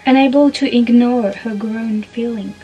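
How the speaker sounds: slow, weighty trailer narration with French accent; dramatic English reading. Shown as a demonstration. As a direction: build drama phrase by phrase, landing loud. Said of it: "mon super accent anglais". (lecture dramatique et lente, accent français)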